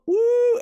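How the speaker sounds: a loud, high cheering woo; excited woo. Real, not acted. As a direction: whoop like a fan in the crowd. Fan cheering MVP's entrance.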